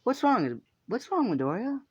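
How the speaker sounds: put-on high-pitched worried girl asking what's wrong; concerned girl voice. Girl on the bench asks crying Izuku, high concerned voice.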